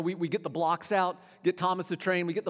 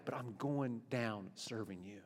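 much lower, softer, slow devotion; quiet resolve; 9 semitones lower, 11 dB quieter, slower than usual. Acted. Believers vowing to serve God, quiet and slow. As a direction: drop it to a quiet vow. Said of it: "preacher dropping to quiet resolve".

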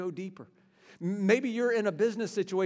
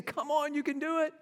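higher mock cheering; cheerleader; 8 semitones higher than usual. Cheerleader parent urging the child on, light mock cheer. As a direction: cheer them on like a coach. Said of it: "we all need cheerleaders".

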